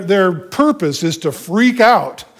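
usual speaking voice

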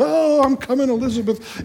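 high-pitched mock wail; hammed-up melodramatic mourning cry; Fred Sanford. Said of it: "the Fred Sanford".

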